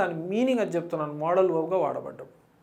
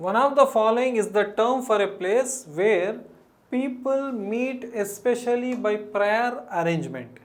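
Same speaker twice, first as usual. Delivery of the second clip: reading the question; 2 semitones higher, much slower than usual. Shown as a demonstration. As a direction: read the question out word by word. Teacher reads question aloud slowly. (నెమ్మదిగా ప్రశ్న చదవడం)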